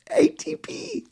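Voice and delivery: high squeaky falsetto price; squealed price. Acted. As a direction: squeak the price in giddy disbelief.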